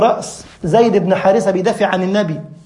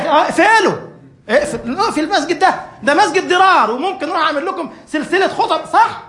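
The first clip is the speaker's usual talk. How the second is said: mock vengeful outburst; 9 semitones higher than usual. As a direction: ham up the angry ego demanding revenge. Preacher mocks vengeful ego, building.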